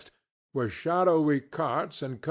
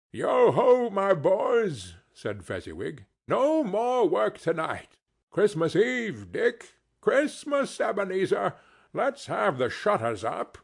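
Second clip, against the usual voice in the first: jovial Fezziwig; 6 semitones higher than usual. Acted. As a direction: boom it out like a merry old merchant. Fezziwig voiced in story.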